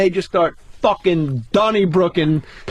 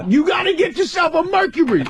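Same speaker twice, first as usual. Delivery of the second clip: Tracy voice; 11 semitones higher than usual. Retold or imitated. Quoting Tracy while punching cars.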